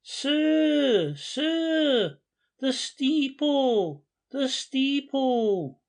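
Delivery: high, far-carrying call, the steeple drawn out slowly; crying out. Acted. Beadle calling Léon back to see the steeple, a high slow cry. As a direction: call after the fleeing visitor, slow and high. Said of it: "he cried".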